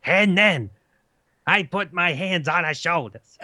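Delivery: shrill comic mock romance; Gilbert Gottfried. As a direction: squawk a love scene. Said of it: "Gilbert Godfrey do Fifty Shades of Grey".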